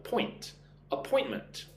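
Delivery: "Point" alone, then "Appointment" stressed on POINT; stress second syllable. Shown as a demonstration. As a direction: hit the middle syllable of "Appointment". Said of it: "Put the stress on the second syllable".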